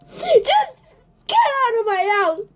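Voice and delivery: plain reading voice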